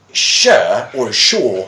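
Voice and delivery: "sure" first rhyming with fur, then "sure" rhyming with shore; two pronunciations. Shown as a demonstration. Teacher explaining words with several pronunciations, contrasted demo. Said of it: "three pronunciations".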